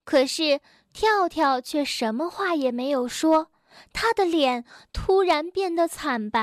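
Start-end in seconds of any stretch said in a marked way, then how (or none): none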